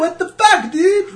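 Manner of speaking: high, loud mock outrage; hammed-up falsetto complaint; the French friend ranting at him, high and loud; outraged French friend. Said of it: "It's an odd French accent".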